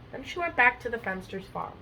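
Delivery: ordinary delivery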